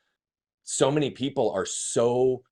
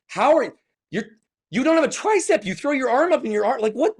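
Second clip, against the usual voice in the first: much higher, louder mock amazement; incredulous people; 8 dB louder than usual. Onlookers asking how he serves, high mock disbelief. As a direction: play baffled onlookers, high and astonished. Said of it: "people looked at me and they're like".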